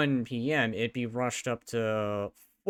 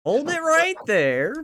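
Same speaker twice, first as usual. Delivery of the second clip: objection shout; 13 semitones higher, 7 dB louder than usual. Acted. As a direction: bellow it like a lawyer objecting.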